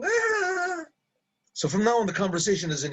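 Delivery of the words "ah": high, drawn-out mock laugh; imitated laughing. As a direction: mimic someone's high laughter. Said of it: "He's laughing".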